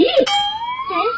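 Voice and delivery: very high drawn-out squeak of disbelief; shocked squeak. Acted. Bahu shocked by news.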